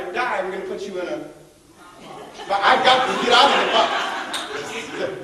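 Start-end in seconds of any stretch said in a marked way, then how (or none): 2.5-5.2 laughing. Real, not acted. Laughing escape from the box.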